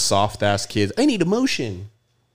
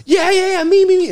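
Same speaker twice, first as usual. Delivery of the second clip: very high, loud squealing "me, me, me"; eager fans clamoring; 9 dB louder than usual. Retold or imitated. Mocking fans at a giveaway, very high loud squeals. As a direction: squeal like a crowd begging for the prize.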